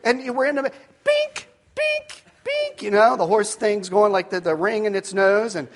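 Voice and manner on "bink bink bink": very high-pitched "bink" chopping noises; imitated axe chops. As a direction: squeak out each metallic hit, spaced apart. Imitating axe hitting horse head.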